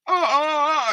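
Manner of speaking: high, loud gasping oh's; mock heart-attack gasps of a young woman; shocked gasps. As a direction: gasp as if your heart stops. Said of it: "she nearly has a heart attack".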